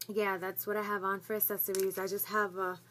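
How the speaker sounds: ordinary delivery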